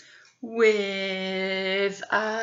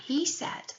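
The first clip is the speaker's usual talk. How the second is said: whisper; 5 semitones higher, 9 dB quieter than usual. A narrator's reading. Narrator whispers the tag line.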